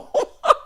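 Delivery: short burst of laughter; real amused laugh. Real, not acted. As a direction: crack up briefly. Laughing about crying into eggs.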